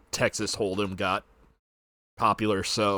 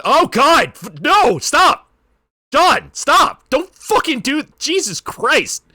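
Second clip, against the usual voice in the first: loud, high shouts of alarm; panicked yelling; 14 semitones higher, 7 dB louder, slower than usual. Real, not acted. Player yells at John in the game, real loud panic. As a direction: scream at the screen to stop it.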